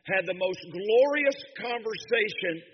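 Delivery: loud, high preaching cry, mother's words ringing out; preaching at full force. Acted. Pastor to congregation, retelling his dying mother's call, in a full preaching roar. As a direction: carry the church up with her joy.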